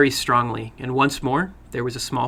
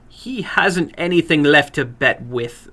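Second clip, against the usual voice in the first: slow, louder, dismissive; scornful older woman; 3 semitones higher, 3 dB louder, slower than usual. Acted. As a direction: cut him down coolly. Woman voiced in story.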